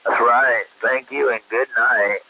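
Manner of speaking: ordinary talk, nothing marked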